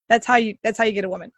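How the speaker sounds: ordinary delivery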